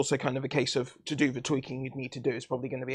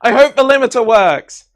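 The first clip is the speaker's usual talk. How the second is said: playful shout; 9 semitones higher, 15 dB louder than usual. Pretend. Testing mic by shouting.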